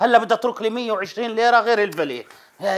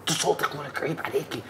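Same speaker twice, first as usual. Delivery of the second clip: urgent whispered hush; harsh whisper; 4 semitones lower than usual. Acted. Husband hushes his wife in public, harsh whisper. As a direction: hiss at her to keep it down.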